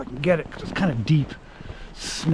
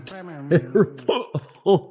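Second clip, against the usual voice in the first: laughing; 7 semitones higher, much slower than usual. Real, not acted. Laughing at his own misery.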